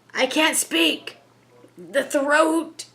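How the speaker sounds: high, strained spirit crying out about the throat; a hint of panicked gasping protest; choking cry. Entity clutching its throat, mildly voiced panicked cry to the investigator. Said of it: "clutching his throat, cried".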